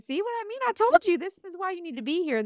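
gently told-you-so; God's voice. Retold or imitated. God points out the grateful woman, knowing. The Lord tells her, retold.

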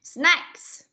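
"Snacks" with a breathy, clearly hissed final S; hissed ending S. Shown as a demonstration. Teacher showing the class the ending sound, hissed final S. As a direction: finish "Snacks" on an audible airy S. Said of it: "ending sound S này".

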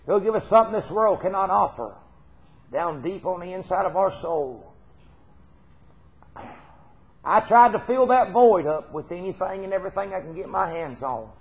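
usual speaking voice

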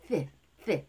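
TH alone, tongue between the teeth; bare "th" demonstrated by itself; isolated TH sound. Shown as a demonstration. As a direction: push air between teeth for a short TH. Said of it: "양치아 사이에".

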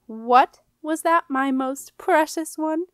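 honey over steel; silky menace. A narrator's reading. Queen silkily threatening Jenna before guests. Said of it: "asked Queen Etheldrada silkily".

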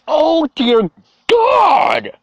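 very high, drawn-out screech; stretched, squealing shock; horrified shrieking. Real, not acted. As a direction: wail it in disbelief at something gory. Horrified reaction to gory gif.

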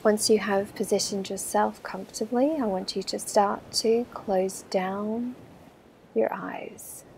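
slow, soft breathing-guide voice; calm meditation voice counting breaths; guided meditation. Acted. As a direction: ease them through each breath, unhurried and hushed.

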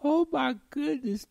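high, amazed exclamation. Real, not acted. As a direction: gasp it out high. Amazed at a very thin girl.

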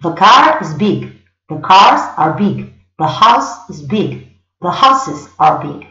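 slow, careful read, each word apart; slow model sentences; slow English demo. Shown as a demonstration. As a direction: model the sentences slowly for learners. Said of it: "En inglés siempre el artículo es igual".

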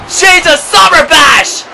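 high, loud and slow shouted callback; excited shout. Real, not acted. Commentator recalling Summer Bash, slow excited high shout. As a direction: bellow it as the history repeats itself.

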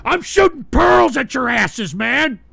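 usual speaking voice

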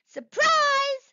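very high, loud call; shouted retry. A narrator's reading. Bee trying to be heard over the buzzing, shouting. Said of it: "she tried again".

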